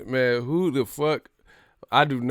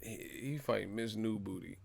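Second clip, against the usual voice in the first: ordinary delivery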